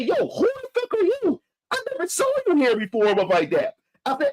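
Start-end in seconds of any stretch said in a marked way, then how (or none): 0.0-3.1 high-pitched heated challenge; high, indignant retold outburst at the woman; angry confrontation. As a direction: retell confronting a stranger, pitched way up. Cussing out the rude woman.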